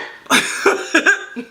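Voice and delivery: sudden high laugh; amused laugh. Real, not acted. Woman recalling her boy's reaction to the message, laughing. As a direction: burst out laughing mid-story.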